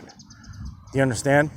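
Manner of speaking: normal talking voice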